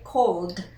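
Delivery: "called" as one syllable, L then D; careful "called" ending in a voiced D; silent E. Shown as a demonstration. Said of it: "We do not pronounce the E".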